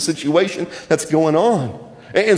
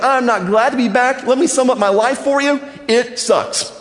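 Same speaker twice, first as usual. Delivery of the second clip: higher, mock-sulky complaint; a hammed-up grumble of a woman fed up with life; bitter Naomi; 7 semitones higher than usual. Naomi snaps back at the welcoming villagers, mock sour. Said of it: "And Naomi's like".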